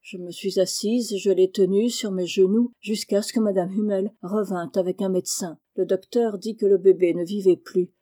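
plain reading voice

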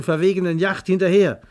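ordinary talk

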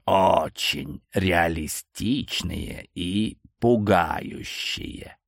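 put-on slow, hushed old man; very old faint voice. A narrator's reading. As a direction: creep it out slowly, like an ancient seller. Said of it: "прошептал старик".

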